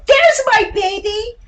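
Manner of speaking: put-on high, slow, delighted call of a mother seeing her child; mother's fond greeting. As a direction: sing it out like a mum spotting her little one across the room. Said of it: "she'd go".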